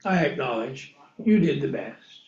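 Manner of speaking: the believer quietly admitting God was right; humbled admission. Pretend. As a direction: concede meekly with head bowed. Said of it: "bow my head and say".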